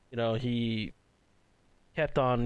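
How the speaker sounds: ordinary talk, nothing marked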